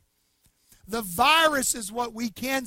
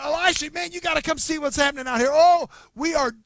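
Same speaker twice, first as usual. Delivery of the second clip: high, loud, breathless panic; a frantic young servant out of breath; panicked servant; 5 semitones higher, 7 dB louder than usual. Retold or imitated. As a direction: blurt it out like a terrified servant. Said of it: "gasping for breath".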